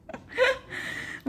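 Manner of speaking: high airy burst of laughter; high breathless laugh. Real, not acted. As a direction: let out a squeaky laugh. Laughs at pesky flies. (উচ্চ স্বরে হাসি)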